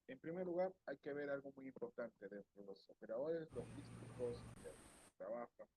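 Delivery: faint, distant audio; bad connection. Real, not acted. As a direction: voice far away and faint. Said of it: "se escucha muy bajo".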